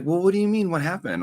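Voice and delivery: bright high eager "what happened?"; eager questioning. Retold or imitated. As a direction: perk up and ask, eager to hear. Retold eager question to boyfriend.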